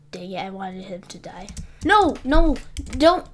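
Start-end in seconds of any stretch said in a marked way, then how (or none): 0.1-1.5 whispered aside under the breath; voiceless sneaky whisper. Real, not acted. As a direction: hush it like a guilty secret. Dark joke about killing a player.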